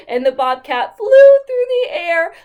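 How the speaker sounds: put-on storyteller making the bobcat fly for children; dramatic excited narration. A narrator's reading. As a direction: narrate the flight with big energy. Bobcat sent flying.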